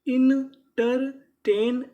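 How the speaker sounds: teacher sounding out इंटरटेन; loud slow demo. Shown as a demonstration. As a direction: call out इंटरटेन slowly for the class. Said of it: "तो पूरी meaning".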